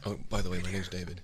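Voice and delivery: breathy whisper close to the ear. Pretend. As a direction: whisper it right into the mic. Whispered binaural greeting.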